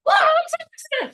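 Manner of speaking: high-pitched hammed-up excited squeal; squealing excitement. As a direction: gush it out like a thrilled fan. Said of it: "You're like".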